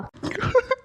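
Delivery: high, squeaky burst of giggling; shy high giggle; embarrassed laugh. Real, not acted. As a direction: laugh it off, caught out.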